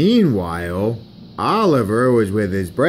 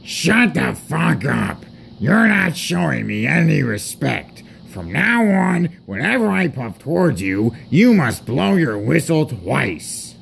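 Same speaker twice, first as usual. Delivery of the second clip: higher-pitched pompous scolding; indignant rebuke; 5 semitones higher than usual. Acted. Gordon demands respect from a smaller engine, indignant. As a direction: lay down the law, affronted.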